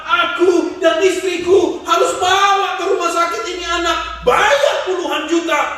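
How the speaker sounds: high, loud, pleading cry to God; anguished outcry. Retold or imitated. Grieving father crying out to God, loud and anguished. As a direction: wail the complaint up at heaven.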